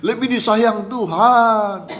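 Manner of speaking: high, loud, drawn-out mocking conclusion; mock reasoning. Preacher voicing envy of the spared neighbours, mock reasoning.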